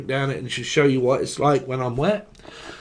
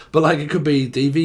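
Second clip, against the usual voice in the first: normal talking voice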